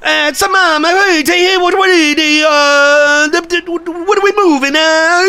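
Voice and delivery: high-pitched fake Italian gibberish; hammed-up fake Italian babble. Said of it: "I didn't know you knew fake Italian".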